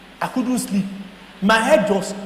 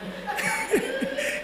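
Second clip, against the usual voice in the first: laugh; 13 semitones higher, 11 dB quieter than usual. Real, not acted. Laughing at pastor's reaction.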